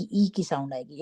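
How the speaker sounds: usual speaking voice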